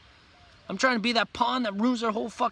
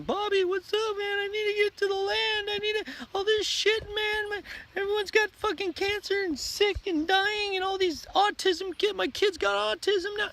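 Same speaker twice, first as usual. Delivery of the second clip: much higher, whiny mock panic; mock panicked whiner; 13 semitones higher than usual. Mocking the latecomer begging Bobby for land, high panicked whine. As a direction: squeak it out like a frantic latecomer. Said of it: "then you're gonna become".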